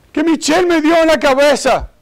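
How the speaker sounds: high-pitched aggrieved child, imitated; Johnny. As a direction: whine it as a kid telling on someone. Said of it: "Y Johnny, ¿qué te pasó". (niño acusando con voz aguda)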